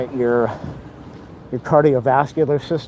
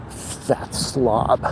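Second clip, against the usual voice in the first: voiceless, under the breath; a hushed, scornful aside; 1 muttered insult; 2 semitones lower, 5 dB quieter than usual. Real, not acted. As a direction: mutter it so he can't hear. Insult at the nearby smoker.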